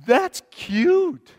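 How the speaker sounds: amused, tender chuckle; laughing fondly. Real, not acted. Baby with birthday cake.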